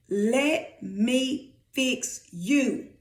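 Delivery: very slow, word-by-word delivery; measured, weighty pronouncement; God speaking. Acted. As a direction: space out each word deliberately. Preacher voices God speaking.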